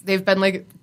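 normal talking voice

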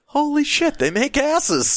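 high-pitched, hammed-up amazement; amazed exclamation. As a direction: exclaim it high, acting out astonishment. Acts out his amazed reaction.

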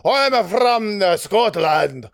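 hammed-up, wobbly mock-Scottish accent; bad Scottish accent. Showing friends his Scottish accent, badly faked. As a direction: badly fake a Scottish accent, drawing out the last words. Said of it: "You did, you did the accent so well".

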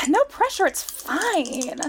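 high sarcastic no pressure; sarcastic, high-pitched. Real, not acted. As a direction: say it with nervous irony. Repeats with sigh, high.

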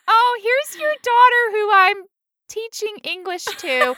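greeting a parent with awkward sweetness; polite teacher voice. Retold or imitated. Meeting her doctor as a kindergarten parent, high and polite. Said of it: "when I was like".

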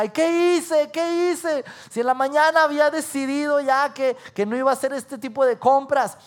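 high-pitched mock lament; acted remorseful wail. Shopper regretting all the bags, wailing high. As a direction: whine in theatrical regret.

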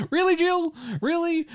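very high, doubting question; incredulous. Retold or imitated. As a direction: squeak out your doubt at a friend's pick. Retold doubting reaction to film.

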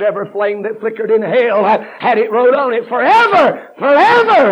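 climbing high and loud to a shout; fiery preaching shout. Retold or imitated. Preacher quoting Spurgeon on hell, building to a shout. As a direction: build into a roar. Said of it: "He said".

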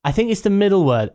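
ordinary delivery

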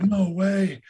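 high-pitched imitation of an amazed kid; freaking out. Girl reacting to a stranger's pile of change, mock freaking out. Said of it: "this girl starts freaking out".

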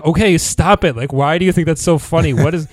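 annoyed John. Retold or imitated. Friend mocked while laughing, annoyed demand. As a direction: snap at him to stop laughing. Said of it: "gets angry and he's like".

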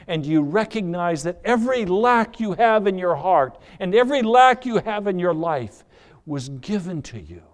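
loud, driving preacher's voice; preaching at full force. Acted. Preacher urging listeners to seek Jesus, loud and driving. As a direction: press the point home to the congregation.

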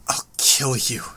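strained, gasping threat, mildly acted; a touch of breathless pain; gasped threat. A narrator's reading. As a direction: force the words out on little breath. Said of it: "gasped out the words".

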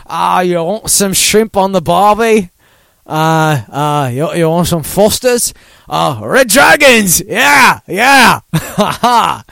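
cartoon Australian building to loud, high cheers; hammed-up Australian accent. Host mocking his own accent as Outback Steakhouse, hammed-up Australian. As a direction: ham up a bad Australian pitchman. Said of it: "he's an Outback Steakhouse".

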